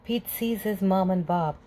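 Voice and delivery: ordinary delivery, nothing marked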